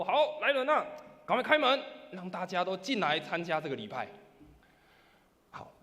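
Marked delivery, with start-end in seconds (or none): 0.0-4.1 loud, higher call to open up. Retold or imitated. Person inside loudly ordering the doors opened. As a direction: call the servants loudly and gladly. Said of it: "他就高声地说".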